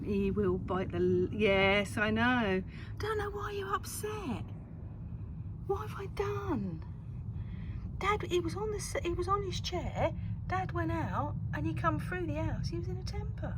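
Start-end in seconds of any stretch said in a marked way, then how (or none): 3.0-13.5 high sing-song voice to the cat; pet talk. Real, not acted. Owner chattering to upset cat Fester about Dad. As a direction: fuss over him in a high coo.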